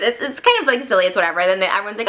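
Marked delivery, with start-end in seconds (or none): none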